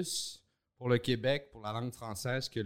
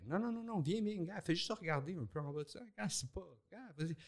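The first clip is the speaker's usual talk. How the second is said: higher, softer coaxing voice, like talking someone down from a ledge; coaxing imitation; 5 semitones higher, 7 dB quieter than usual. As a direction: gently urge a scared friend to jump. Imitating Mike coaxing a scared friend. (imitation de Mike, plus aigu et doux, qui rassure un ami effrayé)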